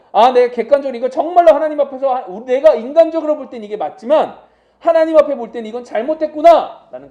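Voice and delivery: acted confession. Preacher voicing a repentant believer, loud and earnest. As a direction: earnestly own up before God.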